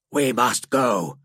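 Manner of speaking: low, slow alien creature voice; snapping alien. Acted. Alien interrupting to get Drake away, low and curt. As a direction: cut in as a blunt, low-voiced beast. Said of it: "snapped Grek".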